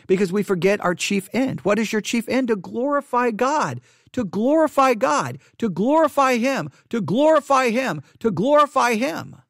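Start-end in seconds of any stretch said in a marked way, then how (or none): 2.5-9.4 higher, slow preaching repetition; preaching emphasis. Acted. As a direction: drive the refrain home. Preaching the chief end.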